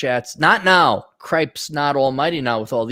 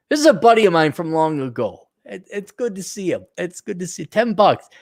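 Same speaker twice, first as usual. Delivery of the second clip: usual speaking voice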